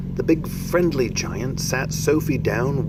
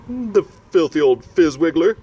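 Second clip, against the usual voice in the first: louder, higher giant shout; angry shout; 8 semitones higher, 5 dB louder than usual. Acted. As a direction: bellow the insult in outrage. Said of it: "shouted the B F G".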